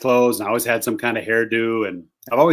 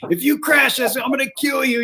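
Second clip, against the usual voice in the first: high, strained mock yell of a scared big guy; imitating a yell; 13 semitones higher than usual. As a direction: voice Phil shrieking threats from the back seat. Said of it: "just yelling".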